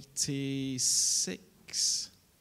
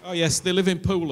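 raised voice, much higher and louder; loud call; 7 semitones higher, 12 dB louder, faster than usual. Real, not acted. Pastor calling out to the congregation, loud. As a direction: call the afterthought out across the room.

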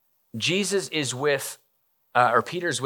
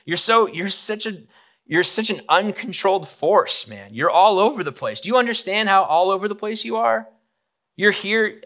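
scolding; 7 semitones higher, 3 dB louder than usual. Acted. Jesus scolding Peter.

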